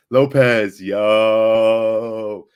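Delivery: loud, drawn-out call of a name; loud shout-out. Real, not acted. Streamer greets returning viewer Lopez with a loud call. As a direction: hail a friend across the room.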